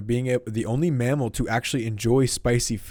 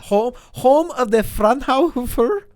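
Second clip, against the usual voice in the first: German accent; 13 semitones higher, 7 dB louder, slower than usual. Shown as a demonstration. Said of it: "in a German accent".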